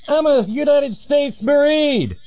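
very high, called-out announcement; proud distant Marine. Acted. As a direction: holler it back over the dune, without real volume. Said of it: "the guy goes".

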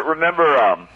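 ordinary talk

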